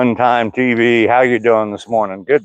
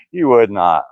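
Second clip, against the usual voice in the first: ordinary talk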